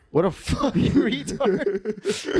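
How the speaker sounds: high, slow, breaking into laughter; real laughter stretching the words; laughing insult. Real, not acted. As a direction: crack up halfway through the jibe. Cracking up at the story.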